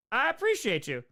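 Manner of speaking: usual speaking voice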